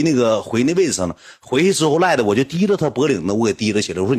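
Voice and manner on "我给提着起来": ordinary delivery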